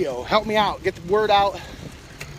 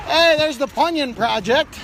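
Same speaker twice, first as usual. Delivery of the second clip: excited call; 7 semitones higher, 5 dB louder than usual. Real, not acted. Calling out on bike.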